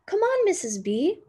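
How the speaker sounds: Mama calls her friend to come help; calling out. Acted. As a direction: call her over cheerfully.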